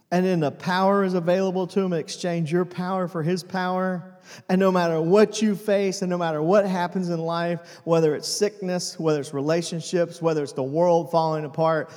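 full-force preaching. Acted.

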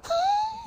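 sudden high giggle at himself; high-pitched laugh. Real, not acted. Laughs at his own hour-long "short" episode.

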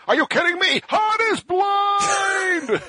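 shrill-high, stretched shout through laughter; mock excited kid shout. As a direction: yell it like a hyped kid who can't believe it. Friend mocks kid's spoiler excitement.

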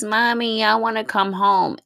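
a hint of a whiny child; imitating her daughter.

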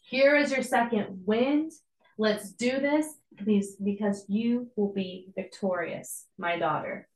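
ordinary delivery

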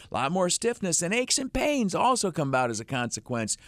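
high, lively pitch man; live ad read. Acted. Host pitches Lattimore Physical Therapy to listeners, upbeat. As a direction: sell it to the listener.

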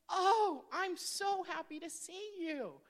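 imitating mom's joy at seeing her; gleeful mom greeting, high and loud; mom's happy greeting.

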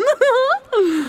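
a pleased little laugh; smug laugh. Acted. Agata laughs showing off pearls. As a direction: laugh at your own luck.

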